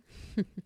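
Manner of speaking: short breathy chuckle; light chuckle. Acted. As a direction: chuckle briefly through the breath. Counting another win with the patient.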